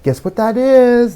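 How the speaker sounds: plain reading voice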